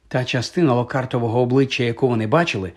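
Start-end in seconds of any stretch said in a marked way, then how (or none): none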